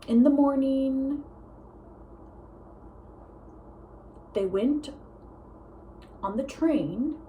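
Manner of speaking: painfully slow monologue, huge gaps between words; very slow talker. Retold or imitated. Granddaughter imitating grandpa's monologues, very slow with long gaps. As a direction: draw each word out with long pauses. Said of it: "he would talk like this".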